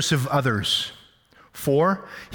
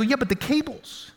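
mock exasperated self; 11 semitones higher, 4 dB quieter than usual. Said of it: "And I go".

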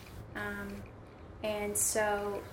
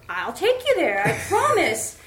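much higher, louder promise; mock insistent driver; 6 semitones higher, 11 dB louder than usual. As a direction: imitate the driver's eager promise. Driver reassuring, retold.

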